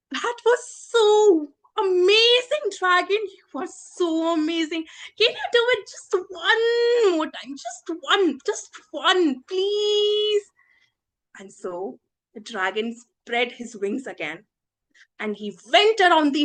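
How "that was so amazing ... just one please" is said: gush, then whine for one more; gushing and begging. Acted. Princess coaxing the tired dragon to fly, pleading.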